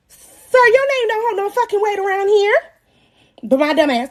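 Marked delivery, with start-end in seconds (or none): 0.1-2.5 high, incredulous objection; mock indignant. Mother rejecting baby daddy's naming idea, mock indignant. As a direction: pitch it way up in disbelief.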